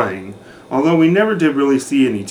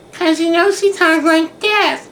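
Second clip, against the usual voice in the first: much higher, slow squeaky put-on girl voice; mocking a houseguest's way of talking, very high and slow; imitating Jessica's voice. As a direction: mimic her tiny high-pitched voice. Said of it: "I can't even get my voice that high".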